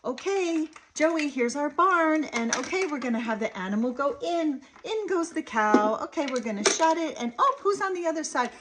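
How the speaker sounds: put-on high, lively, wordy parent-to-child patter; eager running commentary for a toddler; chattering play talk. Shown as a demonstration. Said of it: "We don't wanna say things like".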